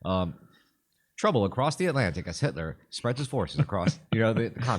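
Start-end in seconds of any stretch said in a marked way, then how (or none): 1.2-4.8 put-on grave 1940s war broadcast in Mid-Atlantic accent; old newsreel announcer with a Mid-Atlantic accent. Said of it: "mastered the Mid-Atlantic voice".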